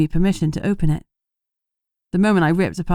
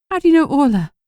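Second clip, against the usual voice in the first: trembling voice; 8 semitones higher, 4 dB louder, faster than usual. A narrator's reading. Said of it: "Her voice trembled".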